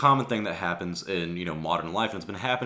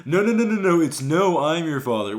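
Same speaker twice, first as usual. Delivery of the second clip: loud, much higher mocking correction; mocking know-it-alls; 7 semitones higher, 7 dB louder than usual. Commenters correcting a meme, loud high mockery. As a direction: mimic pedants jumping in to correct. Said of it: "everyone's like".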